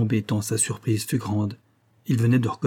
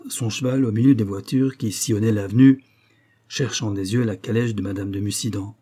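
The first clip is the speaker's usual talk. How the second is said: plain reading voice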